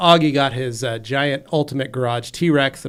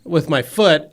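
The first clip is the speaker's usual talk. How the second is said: ordinary talk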